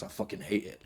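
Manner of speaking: half-whispered grumble; grumbling mutter. Retold or imitated. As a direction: mutter it under your breath like a man stuck in a suit. Quotes carpenter grumbling about suit.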